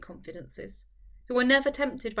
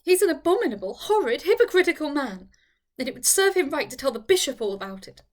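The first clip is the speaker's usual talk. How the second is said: loud, higher, outraged; indignant outburst; 3 semitones higher, 7 dB louder than usual. Acted. Eleanor denouncing Mister Slope to her friend, outraged. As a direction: denounce him with fury.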